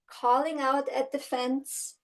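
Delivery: usual speaking voice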